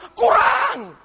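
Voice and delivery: high, loud cry of not enough; complaining shout. Retold or imitated. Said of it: "kita berteriak ngomel". (teriakan mengeluh yang keras dan tinggi)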